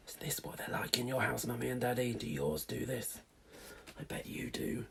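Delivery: hushed playful aside; whisper. Real, not acted. Aside whispered to listening children.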